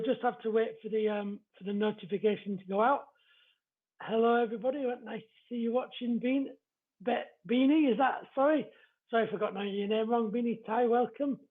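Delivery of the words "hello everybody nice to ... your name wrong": usual speaking voice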